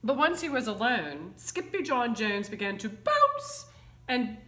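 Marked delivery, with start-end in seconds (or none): 2.9-3.7 "bounce" popped upward at the end; lively bounce. A narrator's reading. Narrator shows kitten starting to bounce, lively "bounce". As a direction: give "bounce" a springy lift.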